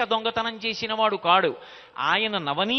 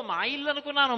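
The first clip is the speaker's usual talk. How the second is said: put-on higher, playful child's innocence; Krishna's cheeky reply; 5 semitones higher, faster than usual. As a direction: act the mischievous boy feigning innocence. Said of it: "అన్నాడు". (అల్లరి కృష్ణుడి అమాయకపు గొంతు)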